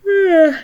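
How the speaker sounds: loud, high yawn; noisy open-mouthed yawn; big yawn. Real, not acted. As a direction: let a full yawn out.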